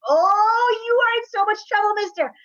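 put-on high, loud child's taunt; gleeful yelling. Boy yelling at muddy brother, gleeful taunting. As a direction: crow it at your brother in a squeal. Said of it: "he yells that at his brother".

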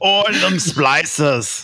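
high, slow hammed-up Australian; mock Australian accent. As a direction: drag it out in a joking Australian accent. Joins the mock Australian accent joke.

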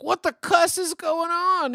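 high, loud Boston cartoon yell; Rocket Raccoon. Host as a space raccoon in a claymation Avengers, hammed-up Boston shout. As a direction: holler it slowly like a panicked Boston raccoon. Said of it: "space raccoon has a Boston accent".

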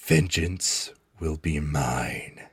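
low, slow, soft hissing threat; slow, quiet vengeful threat; menacing snake woman. Acted. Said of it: "she's like".

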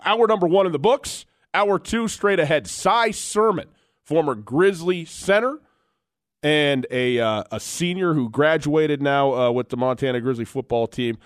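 hyped-up sports radio host tossing to break; radio announcer. Acted. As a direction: sell the next hour like a station promo.

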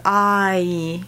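"I" sliding from ah to e; diphthong I. Shown as a demonstration. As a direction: glide ah into ee. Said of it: "it's really a diphthong".

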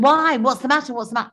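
high, urgent retold questions, starting loud; alarmed questioning. Husband answering her summons, alarmed questioning.

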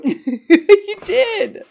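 squeaky laugh through the words; a giggly high retort. Real, not acted. Laughing reply.

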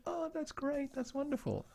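high, soft, happy gushing; retold delight. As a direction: coo with pleasure at lovely news. Retelling parents' delight at baby news.

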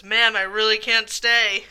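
loud, drawn-out delivery; mimicked song line. Host imitates the duet's line about the driver, loud and drawn out. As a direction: belt it out, stretching the words. Quoting sung part with driver.

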